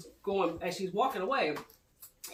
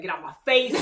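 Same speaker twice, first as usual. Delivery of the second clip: louder mock-angry brush-off; raised-volume retold snap; retold snapping; 2 semitones lower, 7 dB louder than usual. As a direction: punch it out, imitating her irritation. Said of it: "she snaps at Mark".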